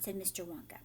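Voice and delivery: ordinary delivery, nothing marked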